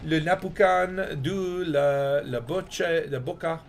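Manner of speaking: slow, drawn-out fake French; improvised faux-French, stretched out; mock French accent. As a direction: put a French accent on made-up words. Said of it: "you just do a French accent and".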